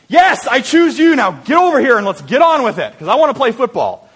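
impatient shout. Retold or imitated. Big John yelling at the small kid to hurry, impatient shout. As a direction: yell it like a captain who wants to get on with the game.

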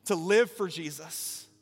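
rousing, emphatic sermon climax; impassioned preaching. Real, not acted. Preacher urges congregation toward baptism, impassioned preaching. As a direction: drive the appeal home to the whole room. Preacher builds to closing appeal.

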